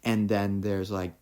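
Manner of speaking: ordinary talk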